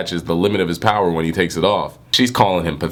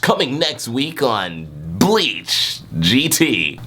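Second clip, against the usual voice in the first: trailer narrator; 2 semitones higher, much slower than usual. Pretend. Parody next-episode promo.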